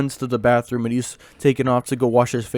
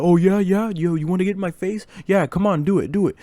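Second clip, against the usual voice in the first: higher-pitched mock challenge; goading taunt; 6 semitones higher than usual. Classmate dares him to hit first, taunting mock challenge. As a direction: imitate a kid egging on a fight.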